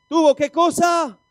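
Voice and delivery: slow, high, shouted question; loud call for response. Real, not acted. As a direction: demand the answer from the whole crowd. Calls for congregation's response.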